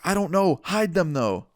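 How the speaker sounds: high, urgent retort, acted out; mock-flustered comeback; imitated panic. Teen passenger telling the driver to hide the shrooms, acted-out panic.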